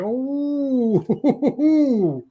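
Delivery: high, drawn-out impressed ooh; mock crowd gasp of delight; crowd's ooh. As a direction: imitate a crowd reacting in awe. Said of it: "everybody watching went".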